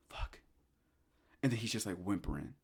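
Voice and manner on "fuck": breathless whispered curse; whispered fuck. Retold or imitated. Old man seeing the ghost's face, whispered curse. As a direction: barely voice it in terror.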